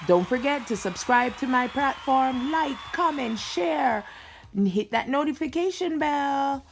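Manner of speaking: energetic YouTube host opener; vlog intro. Acted. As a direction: hype up the viewers for the video. Channel intro over music.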